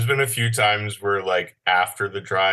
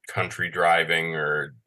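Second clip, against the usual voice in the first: usual speaking voice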